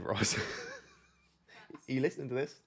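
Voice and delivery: ordinary talk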